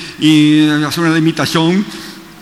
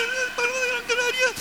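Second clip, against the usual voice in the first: very high and much softer echo; faint echo; 10 dB quieter than usual. Shown as a demonstration. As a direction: answer faintly like a distant echo. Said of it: "El eco de una montaña".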